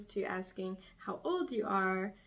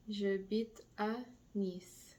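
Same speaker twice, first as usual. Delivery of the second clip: J'habite stressed, slowly; slow model phrase; slower than usual. Shown as a demonstration. Teacher repeating slowly for students. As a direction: draw it out for learners. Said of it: "Now I'm going to say".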